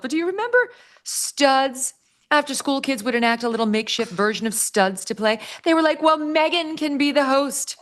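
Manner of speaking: high mocking celebrity voice; mock Meghan voice. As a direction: lightly mimic a self-satisfied celebrity.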